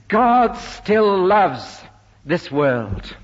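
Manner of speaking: loud, high, drawn-out proclamation; full emphasis. Real, not acted. Preacher declares God's love to the congregation, full emphasis. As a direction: ring out "God still loves" slowly, with force. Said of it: "with all the emphasis I can command".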